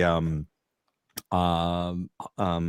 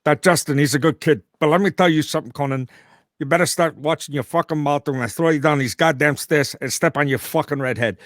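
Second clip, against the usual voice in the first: higher, louder New York tough-guy threat; put-on New York wise-guy impression; Robert De Niro; 6 semitones higher, 4 dB louder than usual. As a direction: menace them like a mob-movie star. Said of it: "you gotta do your De Niro".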